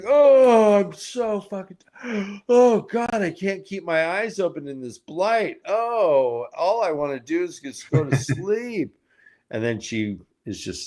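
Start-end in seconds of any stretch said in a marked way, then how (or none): 0.0-8.9 high, loud, drawn-out mock tiredness with a fake gasp; a character faking exhaustion to bait a thief, high and hammed-up; hammed-up sleepy act. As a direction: ham up pretending to doze off so the thief comes. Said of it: "And she's like".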